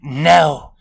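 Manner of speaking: sharp loud no; barked. A narrator's reading. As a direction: bark the no out. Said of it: "she barked".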